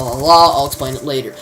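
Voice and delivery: ordinary talk, nothing marked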